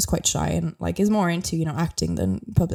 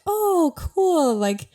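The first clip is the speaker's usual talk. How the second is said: Irish woman humouring a Dublin tourist, forced cheer; polite; 9 semitones higher than usual. Retold or imitated. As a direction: gush politely while hinting otherwise. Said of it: "trying in a polite way to say".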